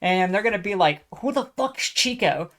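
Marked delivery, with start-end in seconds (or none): 1.2-2.5 baffled voice. Retold or imitated. Imagined people reacting.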